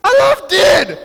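joyful exclamation. Real, not acted. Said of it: "exclaims joyfully".

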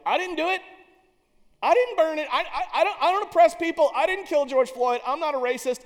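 hammed-up self-defence, half laughing; defensive protest. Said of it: "Everything in me was like".

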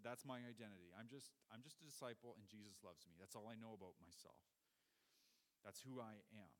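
quiet, hushed voicing of John; soft intimate confession. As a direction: say it softly, as a humble confession. John voiced, soft intimate quote.

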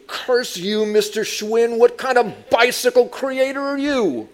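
loud, high cursing at the heavens; hammed-up outraged shout; mock angry rant. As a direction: rail theatrically at the maker. Acted rant at the bike maker.

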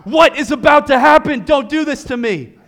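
high, loud shout of suspense; mock panic shout. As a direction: cry out at the cliffhanger. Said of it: "sitting there panting, like".

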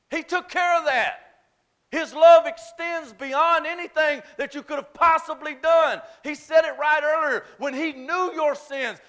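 rising, loud preacher's cry, pushing hard; preaching at full force. Acted. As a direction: preach it, pressing every phrase home.

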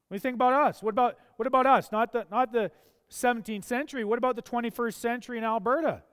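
loud, high, fast preaching that drives each phrase home; fiery preaching. Acted. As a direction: rail at the state of things from the pulpit. Preaching at full force.

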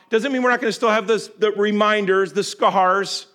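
high, loud preacher building in force; impassioned preaching. Real, not acted. Preacher urging the congregation about God's forgiveness, impassioned.

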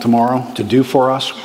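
ordinary delivery

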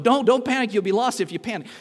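loud, high, urgent mock warning; opposing inner voice. Second inner voice arguing in the cave, loud urgent warning. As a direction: play a frantic voice pleading against panic. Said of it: "another voice is going".

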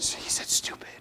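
tattling whisper, breathy and secret; child's whisper. Retold or imitated. Preacher imitating a boy whispering that he said stupid. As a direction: whisper it like a kid telling on someone. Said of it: "Anderson went".

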